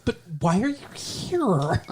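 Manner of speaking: put-on California accent leaking through a British one. Host mimicking the actress's failed English accent, California accent. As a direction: slip into California vowels mid-line. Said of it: "you can just hear the California accent".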